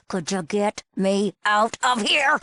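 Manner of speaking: slow, exasperated word-by-word plea; slow, pointed repeat. Acted. Captive girl repeats her plea to rescuer, exasperated. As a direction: spell it out slowly, as if to someone dim.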